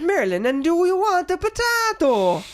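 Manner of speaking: sing-song, hammed-up stage-Irish; Merlin. Mocking the film's Irish wizard, terrible Irish accent. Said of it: "what character is Irish, though. It's, it's Merlin".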